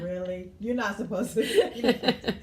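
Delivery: half-laughing can't-believe-it; mother reacting to the husband's comment, amused disbelief; incredulous. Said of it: "I was like".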